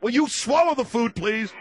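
loud, high exasperated demand; exasperated yell. Real, not acted. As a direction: yell at him to swallow. Host yells at chewing guest.

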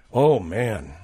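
ordinary talk

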